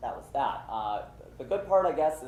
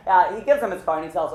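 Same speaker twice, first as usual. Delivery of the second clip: normal talking voice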